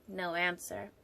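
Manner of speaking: usual speaking voice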